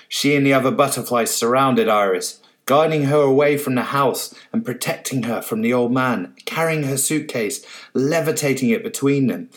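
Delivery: ordinary delivery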